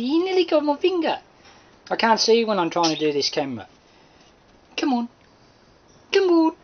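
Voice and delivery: high sing-song coaxing to a bird; coaxing a wild bird. Real, not acted. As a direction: coax the bird onto a finger, high and sweet. Coaxing bird onto finger.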